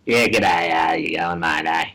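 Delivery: low Australian mumble; mock Australian accent, deep and shady; creepy Australian bloke. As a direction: do a creepy local Aussie, pitched low. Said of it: "Whenever there's a creepy bloke talking".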